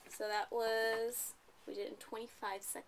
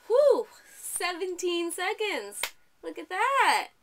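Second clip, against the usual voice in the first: loud, high delighted cheer; excited cheer; 9 semitones higher, 15 dB louder, much slower than usual. Real, not acted. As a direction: celebrate the fast time with a shout. Teacher cheering fast reading time.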